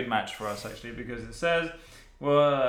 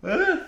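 high yelp; 9 dB louder than usual. Real, not acted. Sudden exclamation.

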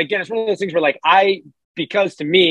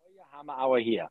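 soft, lower Geordie echo; attempted Geordie accent; 4 semitones lower, 13 dB quieter than usual. Shown as a demonstration. Learner repeating the hammer line softly in attempted Geordie accent. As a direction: try the Geordie phrase quietly to oneself. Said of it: "There you go".

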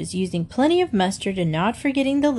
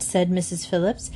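plain reading voice

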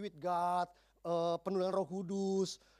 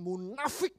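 MUNAFIK drawn out with a spat-out F; exaggerated, sputtering stress on MUNAFIK; spitting emphasis; much slower than usual. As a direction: spit out "munafik" with mock venom. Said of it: "Sampai muncrat".

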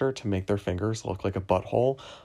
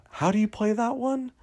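high, loud, rising question; playful game question; 5 dB louder than usual. Shown as a demonstration. Answering a question with a question in a game, high-pitched. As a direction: lob it back high and questioning.

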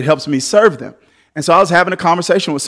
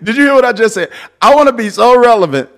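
loud, high preaching; 7 semitones higher, 5 dB louder than usual. Acted. Pastor hyping the congregation, loud preaching. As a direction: drive it home to the crowd.

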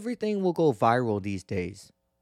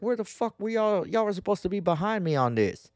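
exasperated call-out; 8 semitones higher than usual. Retold or imitated. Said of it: "And I was like".

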